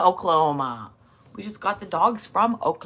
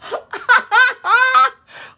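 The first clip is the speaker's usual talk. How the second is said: loud laugh; 10 dB louder than usual. Real, not acted. Laughing at chat joke.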